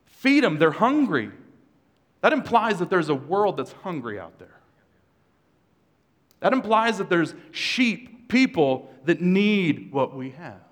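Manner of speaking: loud, rising sermon push; preaching at full force. Real, not acted. Preacher urging congregation, full-force preaching. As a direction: drive the point home to the church.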